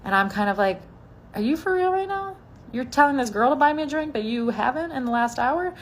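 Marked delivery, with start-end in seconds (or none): 1.3-5.7 high-pitched mock disbelief, easing down; incredulous. Woman to the date who won't buy her a drink, incredulous. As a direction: ask it high, as if you can't believe it. Outraged retort to her date's cheek.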